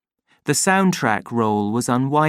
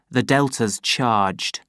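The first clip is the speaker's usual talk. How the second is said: ordinary delivery, nothing marked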